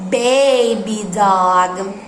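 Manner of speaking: put-on "Baby dog." said slowly and clearly; slow model word. Shown as a demonstration. Teacher models "Baby dog." slowly for repeating. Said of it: "Can you say that?".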